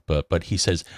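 ordinary talk, nothing marked

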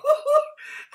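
high, shrieking giggle; hysterical giggle. Acted. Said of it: "hysterical giggle".

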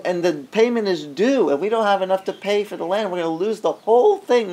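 high-pitched, pleading complaint to God; complaining prayer. Retold or imitated. Driving home, he complains to the Lord, pleading and high. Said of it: "drove all the way back complaining".

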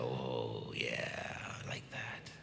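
very low, near-whispered swoon; melting with pleasure. Retold or imitated. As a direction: sigh it softly, savoring the words. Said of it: "that just makes me go".

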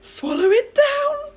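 high, loud, slow excited call; cried out. A narrator's reading. George urging grandma to swallow the medicine. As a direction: sing it out gleefully. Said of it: "George cried out".

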